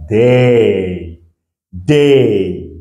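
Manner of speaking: day with a long, drawn-out "ay"; day held long, pausing between; A plus Y sound. Shown as a demonstration. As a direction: stretch day out twice for learners. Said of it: "ça devient".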